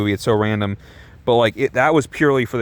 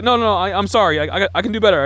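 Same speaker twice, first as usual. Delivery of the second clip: flustered apology; 6 semitones higher, faster than usual. Retold or imitated. Acts out singer apologising for bad song.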